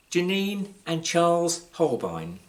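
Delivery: ordinary delivery, nothing marked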